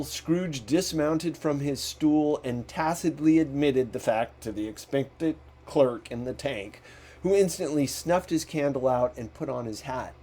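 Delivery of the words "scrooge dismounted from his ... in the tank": ordinary delivery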